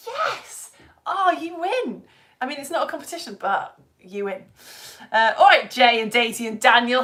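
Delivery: usual speaking voice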